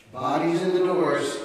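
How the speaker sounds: high, loud, slow call. Retold or imitated. Guard calling room checks down the cells, loud slow call. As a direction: bellow it down the corridor. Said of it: "they would say".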